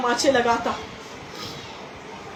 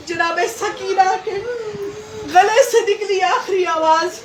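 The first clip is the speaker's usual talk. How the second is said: weeping lament; 6 semitones higher than usual. Acted. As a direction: voice breaking into a sob, pitched high. Sakina's last cry recounted. (रोती हुई, टूटती ऊँची आवाज़)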